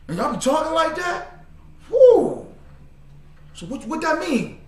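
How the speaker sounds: high-pitched amazed questioning; fascinated newcomer impression. Preacher imitates newcomer asking what Hebrew means, amazed. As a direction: act out a wowed newcomer, voice way up.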